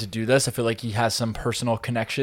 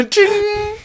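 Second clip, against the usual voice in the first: slot machine ching; 6 dB louder than usual. Pretend. Slot machine sound effect.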